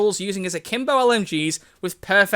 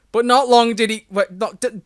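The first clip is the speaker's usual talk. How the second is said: ordinary delivery, nothing marked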